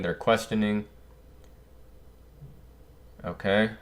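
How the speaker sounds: normal talking voice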